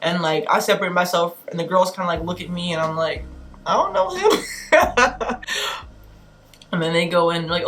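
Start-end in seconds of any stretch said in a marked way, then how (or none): 3.7-5.4 high-pitched quick denial breaking into a laugh; high little voice, about to laugh; squeaky disclaimer. Acted. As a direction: squeak it out, disowning someone. Disowning him to the girls.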